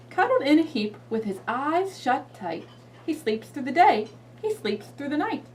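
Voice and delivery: expressive storybook reading; reading to a toddler. Shown as a demonstration. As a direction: read it slow and dramatic for a child. Reads Bear Snores On.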